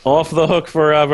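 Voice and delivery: loud, high victory cry; triumphant cheer. Real, not acted. Player declaring freedom from finishing the service, triumphant cheer. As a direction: crow it over the others. Gloats over escaping the challenge.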